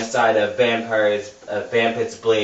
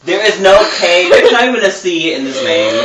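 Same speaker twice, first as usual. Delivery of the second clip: laughing protest; 8 semitones higher, 10 dB louder, faster than usual. Real, not acted. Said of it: "insisting on the spelling".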